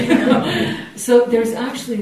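high and loud, laughing it out. Real, not acted. Laughing to the teacher after her money story, high and loud. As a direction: burst out laughing.

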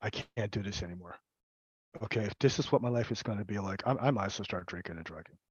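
low, soft and defeated; quiet hopeless. Retold or imitated. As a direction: murmur it like giving up. Quoting his own despair.

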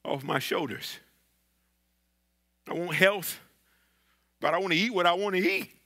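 ordinary talk

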